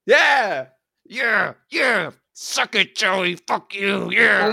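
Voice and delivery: loud, high triumphant crowing; gloating victory cheer. Real, not acted. Taunting Joey after winning the bet, loud and gloating. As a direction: rub the win in his friend's face.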